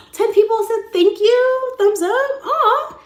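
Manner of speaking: very high, loud squeal; slow, drawn-out mock gushing; gushing delight. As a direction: squeal it like it's the biggest thrill. Acts thrilled at ten likes.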